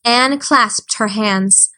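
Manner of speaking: plain reading voice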